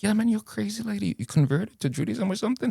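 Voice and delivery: very high-pitched mock disbelief; incredulous. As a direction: question it in a high, astonished squeal. Retold disbelief at lady's Yemeni origin.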